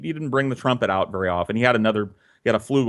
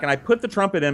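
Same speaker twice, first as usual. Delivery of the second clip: usual speaking voice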